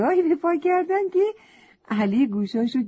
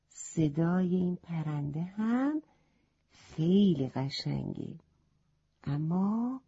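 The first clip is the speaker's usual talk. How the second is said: ordinary delivery, nothing marked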